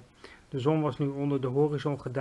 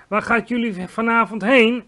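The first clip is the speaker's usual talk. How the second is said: loud, higher call after passing giants; shouting; 7 semitones higher, 8 dB louder than usual. A narrator's reading. The giant yelling after the other giants, loud shout. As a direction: shout it across to the galloping giants. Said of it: "schreeuwde G V R".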